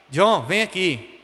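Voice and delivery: slow, low-key call to a son; calm mother calling. Retold or imitated. Mother calling her son when tranquil, calm and slow. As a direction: call him over calmly, drawn out. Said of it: "quando ela tava tranquila".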